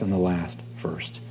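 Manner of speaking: breathy hushed "and the last first", slow; whispered. Real, not acted. Preacher closing the verse to his church, whispering. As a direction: let it fall to a whisper.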